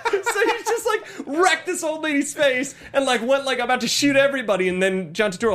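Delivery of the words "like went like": ordinary talk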